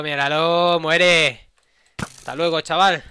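loud high gloating yell; triumphant shout. Real, not acted. Kills enemy.

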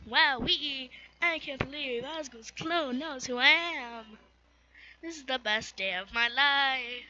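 overjoyed skeleton who can't contain himself; Papyrus excited. Acted.

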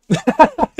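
high loud burst of laughter; a cracking-up squeal; shrieking laugh. Real, not acted. Viewer cracking up at Momiji, loud high laugh.

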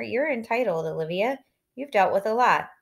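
plain reading voice

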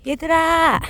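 loud high call across a distance; calling the dogs. Real, not acted. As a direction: hail the dogs from far off.

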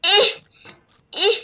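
ordinary talk, nothing marked

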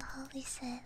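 ordinary talk, nothing marked

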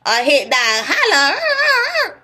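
loud, very high, drawn-out whoop; playful holler. Pretend. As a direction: holler it out and hold the last note high.

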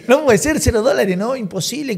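mock outrage over the empty account; outraged protest. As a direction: retell the bank disaster in rising alarm.

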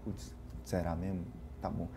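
very low, soft self-talk; hushed, low retold thought; retold private doubt. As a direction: murmur it as if thinking to yourself. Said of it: "Aí eu".

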